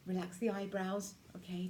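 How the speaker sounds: normal talking voice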